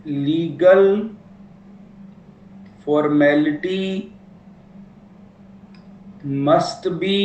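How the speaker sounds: each word spaced out slowly for notes; slow dictation. Shown as a demonstration. Teacher to students, a point to copy down, slow dictation.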